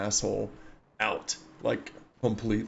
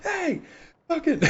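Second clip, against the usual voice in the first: very high mock holler; imitated yell; slower than usual. Gunners relay the news across trucks, high mock yell. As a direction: pass the word along in a high call. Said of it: "the gunners are just yelling at each other".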